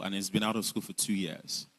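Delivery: ordinary delivery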